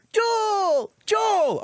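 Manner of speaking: high, carrying mock call. Retelling Ellie calling out on horseback.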